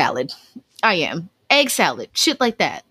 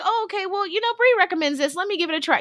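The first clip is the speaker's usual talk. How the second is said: naive; 6 semitones higher, 5 dB quieter, faster than usual. Retold or imitated. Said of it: "buying this shit like".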